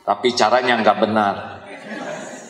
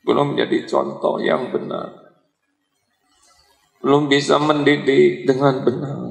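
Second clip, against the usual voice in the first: prayerful plea. Acted. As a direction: pray humbly, asking forgiveness. Opening prayer held apart from talk.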